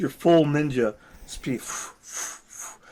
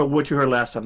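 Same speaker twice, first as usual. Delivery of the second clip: ordinary delivery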